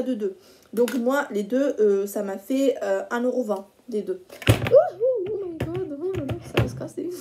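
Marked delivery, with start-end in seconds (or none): 4.5-7.1 startled. Real, not acted. Teapot nearly falls.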